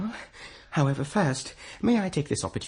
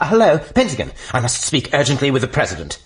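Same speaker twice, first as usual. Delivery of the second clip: normal talking voice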